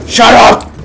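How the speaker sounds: sudden loud, high yell; mock angry shout. Speaker hushing an imagined cheering crowd, sudden shout.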